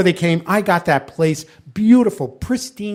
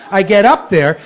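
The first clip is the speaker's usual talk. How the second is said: ordinary delivery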